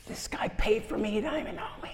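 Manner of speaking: high, breathless retold whisper; a mock whisper of disbelief; hushed whisper. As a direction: whisper it in amazement.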